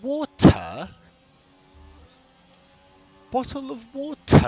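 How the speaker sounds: high, loud over-the-top British "Bottle of water", crisp T's; exaggerated British accent. Shown as a demonstration. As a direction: ham up a British accent, pitch way up. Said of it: "in the most British way you could possibly do".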